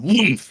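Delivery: loud booming woomph sounds; mock coffin thuds; coffin thud noise. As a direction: thump it out like a heavy coffin hopping. Coffin hit by object.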